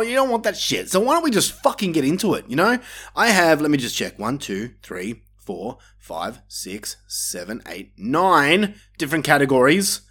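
usual speaking voice

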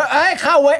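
laughing mock yell; half-laughed angry yell; mock angry shout. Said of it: "โกรธมากตะโกนว่า".

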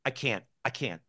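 softly repeated, small voice of giving up; defeated self-doubt. Acted. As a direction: mimic someone defeated, quietly repeating it. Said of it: "that seems to be the way it is".

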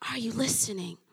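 breathy whispered question. Acted. God repeating the question to the believer, whispered. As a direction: lean in and whisper it.

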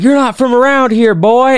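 high-pitched mock yell; imitating someone yelling, pitch jumping up; retold scream. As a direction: holler it like the wound-up guy at the window. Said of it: "screaming that at the top of his lungs".

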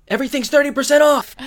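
gasp, then high, loud, fast cry; panicked store-clerk yell, rushed and high; frantic retold outburst. Concussion-bound Eric panics, shouting store slogan.

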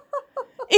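high cackle; short, high-pitched cackling giggle; cackling laugh. A narrator's reading. John laughs contentedly, high cackle. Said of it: "Hij lacht zijn kakellachje".

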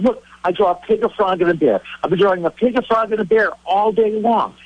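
high, pleading mock panic; acted-out frantic explaining; freaking out. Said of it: "I'm sitting there freaking out".

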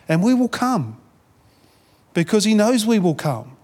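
usual speaking voice

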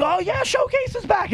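very high squeaky gossip voice; gossipy fan voice. Retold or imitated.

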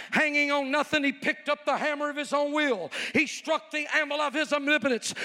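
loud, high, rolling revival preaching; Pentecostal evangelist voice. Retold or imitated. Preacher showing off his Pentecostal voice to Harvard, booming. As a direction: preach it with full evangelist fire. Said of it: "I kicked in my Pentecostal voice".

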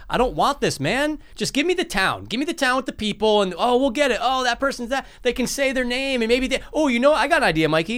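high-pitched, worked-up pleading; exasperated plea. Acted. Fed-up viewer begging for just the town, exasperated plea. Acts out complaint and pitch idea.

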